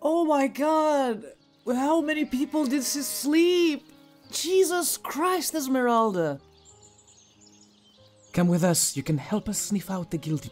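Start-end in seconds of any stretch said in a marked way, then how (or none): 0.0-6.4 shocked. Real, not acted. Shocked by the dog's reaction.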